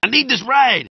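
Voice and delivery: normal talking voice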